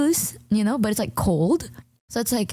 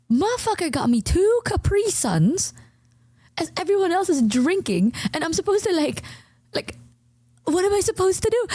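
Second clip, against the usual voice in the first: exasperated rant; 10 semitones higher than usual. Real, not acted. Retelling outrage at Capri Suns.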